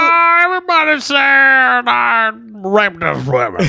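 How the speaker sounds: high, loud-ish garbled comic impression; slow drawn-out words, strained and high; Bill Cosby. Mocking a disgraced comic to a friend, hammed-up impression. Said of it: "now I can't do Bill Cosby".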